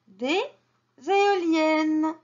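des, pause, then zéoliennes high and loud-edged; liaison. Shown as a demonstration. Teacher repeats the rule's example, liaison z stressed. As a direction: split after des, start éoliennes on a z. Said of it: "On fait la liaison".

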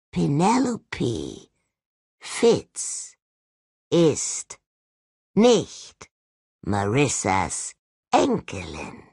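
word by word, long pauses between; very slowly. Acted. Skull spells it out for Lucy, very slowly word by word. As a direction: spell it out slowly like to a slow child. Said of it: "Ich sag's mal ganz langsam".

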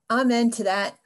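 normal talking voice

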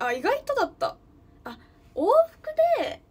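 sudden high, loud "あ" of realization; surprised. Real, not acted. Streamer checking the Nagano–Tokyo fare, surprised and loud. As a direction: caught off guard by the ticket price.

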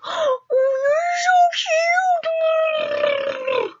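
very high, very slow drawn-out coo. Retold or imitated. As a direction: melt over a cute kid. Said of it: "I'm like".

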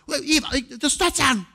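mock distracted dad. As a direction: wave the kid off mid-game. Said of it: "Little Eva distracts me".

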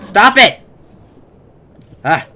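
high loud protest; shouting. Pretend. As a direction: yell at the attacker. Being attacked.

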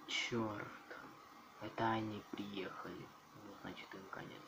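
unvoiced whisper. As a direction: hush it while hiding. Whispering while hiding.

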